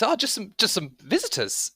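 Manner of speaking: high, halting reply; young man answering. Acted. Young man explains visitors to those inside, hesitant. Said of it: "He goes".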